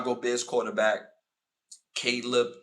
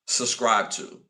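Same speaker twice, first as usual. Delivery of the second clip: ordinary talk, nothing marked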